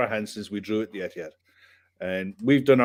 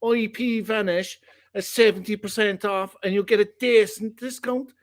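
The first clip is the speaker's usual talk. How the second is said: mock Irish Cork accent, pitched up and lilting; playful Cork accent sponsor read mocking a friend's request; 10 semitones higher than usual. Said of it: "I should be doing the I P Vanish in the Cork accent".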